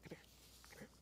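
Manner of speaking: hushed, tempting whisper; a sly whisper from the enemy; enticing whisper. Pretend. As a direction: lure the sheep close, voiceless. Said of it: "the enemy will whisper in our ear".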